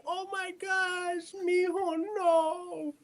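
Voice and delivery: high, slow hammed-up gasp of alarm; mocking the fussing grown-up; mock panicked adult. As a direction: overreact in a shrill mock panic. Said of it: "if he sees me".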